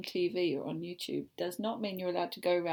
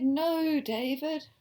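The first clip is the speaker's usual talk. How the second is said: louder, higher scolding no; stern no; 5 semitones higher, 6 dB louder, much slower than usual. Acted. Mother reading the book, telling David no. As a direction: stop him firmly. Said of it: "I said".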